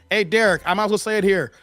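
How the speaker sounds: ordinary talk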